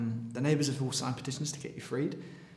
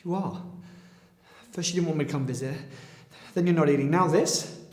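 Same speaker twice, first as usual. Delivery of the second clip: raised, furious voice; angry shout; 4 semitones higher, 10 dB louder than usual. Acted. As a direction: yell at her in frustration. Son shouts at his giving-up mother.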